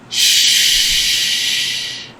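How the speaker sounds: mock shushing, held long. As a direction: hush someone with one long hiss. Shushes the woman he addresses.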